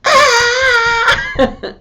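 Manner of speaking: high piercing mock scream; child's scream imitated with a laugh; imitated shriek. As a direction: shriek like a furious toddler. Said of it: "And he did this".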